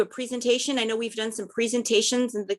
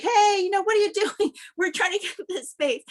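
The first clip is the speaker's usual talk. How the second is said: raised, higher-pitched retold shout out the window; mock yell; 9 semitones higher than usual. Retelling coworker yelling at driver taking their space, mock shout. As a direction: imitate a friend yelling over a parking space, half-laughing. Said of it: "he starts yelling".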